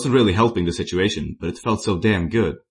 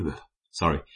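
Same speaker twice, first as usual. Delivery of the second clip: ordinary delivery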